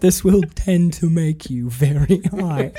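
slow, strained mock breath-hold; holding in smoke. Correcting the quote from the film, slow held-breath voice. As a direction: imitate squeezing words out over held smoke. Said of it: "He's trying to talk and hold it".

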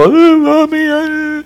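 put-on high, whimpering little child calling Mommy; a child's whiny night cry. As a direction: play the small kid waking up scared.

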